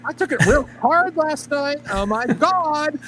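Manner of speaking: mock effeminate voice. Mocking the driver dramatically.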